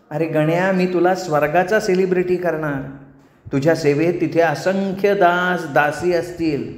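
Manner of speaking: sweet, grandly promising nymph; tempting apsara. Acted. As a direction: tempt him with heavenly luxury. Menaka tempts with heaven. (भुरळ घालणारी अप्सरा)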